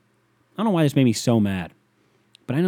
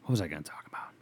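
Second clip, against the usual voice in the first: breathy whisper to himself; 5 semitones lower, 13 dB quieter, faster than usual. Real, not acted. Podcaster whispering to himself while losing his train of thought. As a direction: whisper it under your breath.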